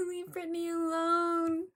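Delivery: high, drawn-out mock plea; Chris Crocker impression. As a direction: imitate a tearful fan's plea, slowly. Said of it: "Chris Crocker".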